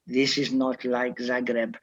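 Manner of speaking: put-on Croatian accent. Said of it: "in his best Croatian accent".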